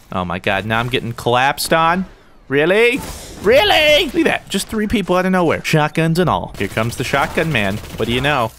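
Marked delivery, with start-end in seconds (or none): 2.5-3.0 higher, disbelieving question; first-stage disbelief, pitch lifted; incredulous. Real, not acted. Disbelief at being ganged up on. 3.4-4.7 exasperated high-pitched outburst; outraged disbelief. Real, not acted. Repeats disbelief, more outraged.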